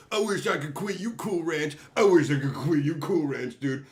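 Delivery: mock heartbroken plea, played for laughs; hammed-up Brokeback quote. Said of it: "we could redo Brokeback Mountain together".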